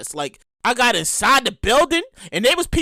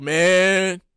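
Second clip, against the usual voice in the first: much lower, slow tired drawl; lower, slow deadpan poll worker; 10 semitones lower, much slower than usual. Retold or imitated. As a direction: sigh it out like a weary poll attendant. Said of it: "he was like".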